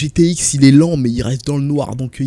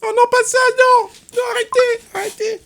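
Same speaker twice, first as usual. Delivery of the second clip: very high falsetto screams; mock panic; 4 dB louder than usual. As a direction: squeal in pretend terror of an arrest. Pretend police raid, begging to stop. (panique jouée, cris suraigus)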